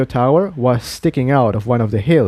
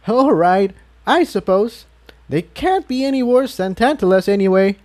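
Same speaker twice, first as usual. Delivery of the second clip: higher character voice; 9 semitones higher than usual. A narrator's reading. Tiptoe's voiced reply.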